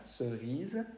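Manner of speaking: "cerise" softly, seu-riz, rounded first vowel; demonstrated French pronunciation. Shown as a demonstration. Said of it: "se puede pronunciar cerise". ("cerise" suave, con la E francesa redondeada)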